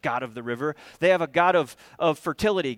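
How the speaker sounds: ordinary talk, nothing marked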